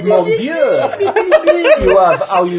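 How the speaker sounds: put-on hammy French accent, slow and high. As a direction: ham up a French chef voice.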